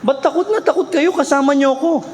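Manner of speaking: Jesus chiding the frightened disciples; Jesus gently scolding. Retold or imitated. Jesus asks the disciples in the storm, puzzled chiding. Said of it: "Sabi ng Lord".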